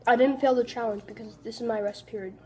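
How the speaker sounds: ordinary talk, nothing marked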